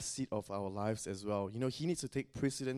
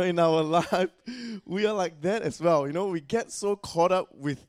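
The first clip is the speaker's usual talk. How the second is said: louder; 5 semitones higher, 10 dB louder than usual. Real, not acted. Carries on after the joke.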